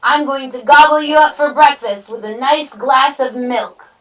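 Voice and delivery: high, loud gobbling threat; big storybook villain bluster; roaring troll. A narrator's reading. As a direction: roar it as a hungry troll. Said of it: "roared the troll".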